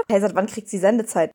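ordinary talk, nothing marked

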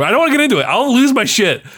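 half-joking, about to blow; playfully riled up; worked up. Real, not acted. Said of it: "I've had a little bit of whiskey".

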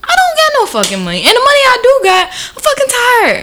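high-pitched fed-up protest; worked-up comic rant; exasperated. Real, not acted. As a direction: let exasperation push the voice way up. Exasperated rant about being asked.

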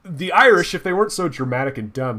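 normal talking voice

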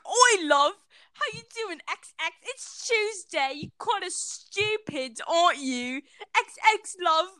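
high, exaggerated British accent; mock British accent. Mimicking British people talking to a love, mock British accent. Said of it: "I don't know how to do British accent".

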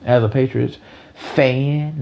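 "fan" stretched out slowly for emphasis; stress on fan. Real, not acted. Podcaster declaring team loyalty, stress drawn out on "fan". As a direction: lean hard and long on "fan". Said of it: "I put emphasis on that word fan".

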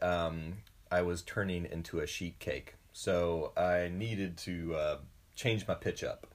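ordinary delivery

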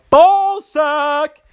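silly voice. Pretend. Joke sign-off voice.

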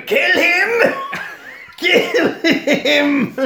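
high, loud killer-doll shriek with cackling; shouted doll orders. Acted. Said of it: "the head starts shouting instructions".

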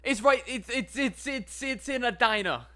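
high, stammering dolphin voice; flustered dolphin. Acted. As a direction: splutter like a caught-out dolphin. Dolphin flustered over directions.

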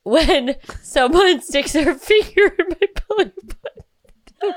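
squeaky high giggles with tears, words barely held together; trying to explain through helpless, teary laughter; laugh-crying. Real, not acted. Still crying with laughter while explaining it to her cohost. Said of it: "She's crying real tears".

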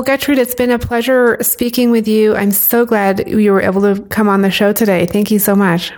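ordinary delivery

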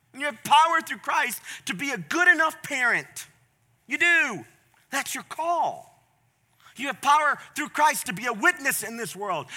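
forceful, rousing sermon delivery; full-force preaching. Real, not acted. Preacher telling believers they have power through Christ, rousing. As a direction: drive each promise home with punchy emphasis.

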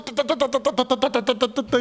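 high rapid "tu tu tu" gun rattle; gunfire noise. Retold or imitated. Robot shoots George, mouth-made gunfire rattle. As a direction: mimic machine-gun fire with the mouth.

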